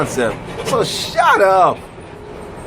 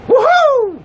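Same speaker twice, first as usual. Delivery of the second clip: high, loud woohoo; excited whoop; 5 dB louder than usual. Acted. Whooping about a woman's skill.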